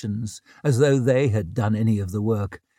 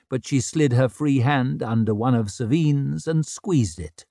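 ordinary delivery, nothing marked

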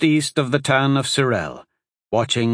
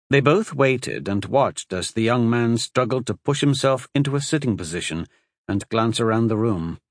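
usual speaking voice

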